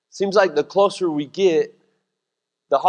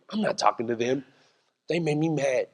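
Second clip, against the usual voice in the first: quiet sulk; 4 semitones lower, 9 dB quieter than usual. Retold or imitated. Voicing sulking spouse.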